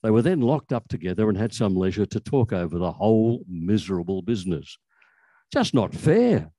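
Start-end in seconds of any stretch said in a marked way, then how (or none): 5.5-6.5 higher, a mild whiny moan; a lightly voiced complaint. A narrator's reading. Vox locked up with Bromley, mild moan. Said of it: "Vox moaned".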